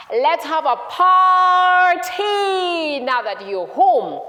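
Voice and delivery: loud, high, hugely stretched "party"; overjoyed drawn-out proclamation; jubilant shout. Acted. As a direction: announce the celebration at full voice. Father announces celebration.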